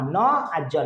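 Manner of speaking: न आ ज, said sound by sound; broken into sounds. Shown as a demonstration. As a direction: sound out न, then ज, joined by आ. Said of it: "इसको क्या pronunciation करोगे?".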